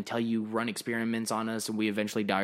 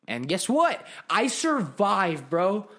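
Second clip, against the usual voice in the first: higher and louder, bragging you made it; triumphant boast; 8 semitones higher, 5 dB louder than usual. Pretend. Host bragging as a survivor who kept his bakery, elated. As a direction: crow about surviving, pitched up and loud.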